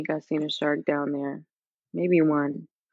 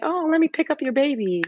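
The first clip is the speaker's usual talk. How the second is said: high cooing voice, much higher; 10 semitones higher than usual. Retold or imitated. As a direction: coo like an eager grandma reaching for the baby. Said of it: "they're like".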